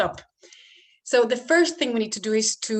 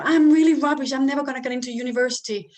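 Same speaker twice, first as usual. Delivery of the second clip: put-on higher, louder wail of a teen, rushing; a teen blurting out despair; despairing child; 4 semitones higher, 7 dB louder, faster than usual. Child in despair, voiced by parent.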